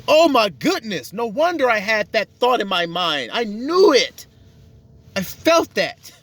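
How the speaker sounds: a touch of amazed man; stunned realization. Retold or imitated. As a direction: say it like your gut feeling was right all along. Man realizes his foreboding.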